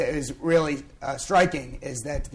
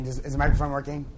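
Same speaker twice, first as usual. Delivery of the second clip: ordinary delivery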